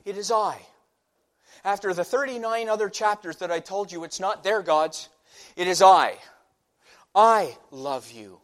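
loud, high emphatic preaching; preaching at full force. Acted. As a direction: proclaim it to the congregation.

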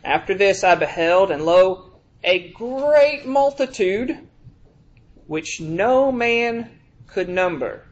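slow, solemn scripture reading; preacher reading Revelation slowly to the congregation. Acted. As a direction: read the verse with weight, drawing it out.

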